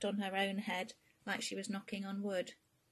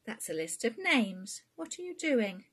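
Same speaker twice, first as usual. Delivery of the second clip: sharp, loud and rising mum catching her daughter out; suspicious mother's sharp question; startled mum; 5 semitones higher, 8 dB louder than usual. Acted. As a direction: demand it louder, pitch climbing. Queenie catches Bea snooping.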